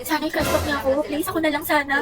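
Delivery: dreamy soft pleading. Acted. Daydreaming about crush.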